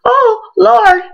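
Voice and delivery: high, tearful wail to the Lord; tearful retold wail; crying out. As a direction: cry out in mock anguish. Crying out recalling a scare.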